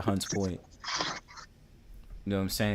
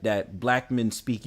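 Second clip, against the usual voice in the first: ordinary talk, nothing marked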